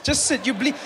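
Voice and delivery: ordinary talk, nothing marked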